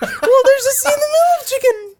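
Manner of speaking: laughing. Real, not acted. Laughing argument over spelling.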